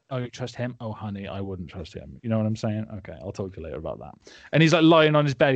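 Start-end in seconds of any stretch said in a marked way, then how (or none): 0.1-2.2 hushed, low confidential aside; mock gossip. Bond gossiping about the boss's staff, hushed mock gossip. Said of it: "gossiping about his staff". 3.0-4.1 quick, low, hushed sign-off; mock gossip. Bond gossiping to the cartel leader, hushed mock gossip. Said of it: "gossiping about his staff".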